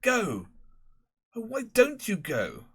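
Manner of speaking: a harassed master trying to start a race; fussy schoolmaster. Acted. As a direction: Prendergast instructing the boys before the race. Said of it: "Mister Prendergast could be heard saying".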